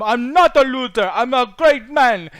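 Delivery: terrible, muddled put-on accent, high and loud; Pedro Pascal. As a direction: proclaim it in a badly faked accent. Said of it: "That is the worst accent for Pedro Pascal".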